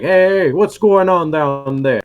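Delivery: high, loud, drawn-out holler; hooting and hollering. Acted. Men on the parapet yell to gobos below, hollering. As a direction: call down from high up. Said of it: "start to hoot and holler".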